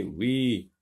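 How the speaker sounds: ordinary delivery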